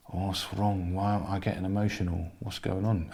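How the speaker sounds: low, hushed thinking to himself; retold private thought, very soft and low; quiet inner questioning. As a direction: puzzle it over under your breath, pitched low.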